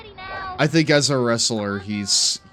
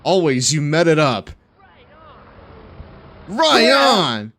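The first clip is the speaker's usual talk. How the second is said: loud, high hyped yelling; excited shouting; 5 semitones higher, 6 dB louder, slower than usual. Real, not acted. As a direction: cheer it out like a stoked fan. Hyped reacting to wrestling clip.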